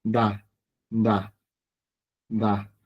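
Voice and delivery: "Da, da, da" with a soft voiced D; D sound. Shown as a demonstration. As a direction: say "Da, da, da" as separate syllables starting with D. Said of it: "la vamos a pronunciar como una D".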